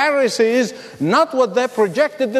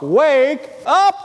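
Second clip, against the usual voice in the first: a raised call, pitched up, not a full yell; 5 semitones higher than usual. Retold or imitated. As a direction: call it out like a wake-up cry. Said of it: "it would be a yelled out".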